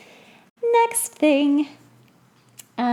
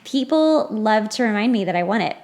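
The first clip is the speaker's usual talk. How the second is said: ordinary delivery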